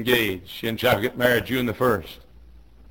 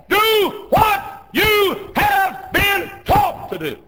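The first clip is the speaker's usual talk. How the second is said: very high, slow, separated shouted words; 6 dB louder, much slower than usual. Real, not acted. Preacher orders obedience to teaching, slow shout. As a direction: hammer each word out slowly at full voice. Climax, word by word command.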